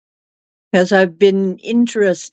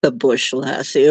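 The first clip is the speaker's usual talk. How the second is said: lower, drawled mock-Aussie repeat; Australian accent; 6 semitones lower than usual. Repeating the bush joke to chat in a low mock Australian accent. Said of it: "That isn't Aussie at all".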